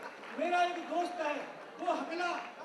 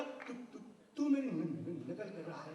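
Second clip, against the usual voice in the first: stammering villager; 9 semitones lower than usual. Retold or imitated. Villager accusing the constable back, stammering. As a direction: repeat each syllable, stuck. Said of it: "गांव वाला बोला".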